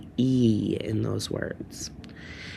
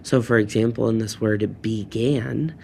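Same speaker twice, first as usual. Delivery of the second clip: ordinary delivery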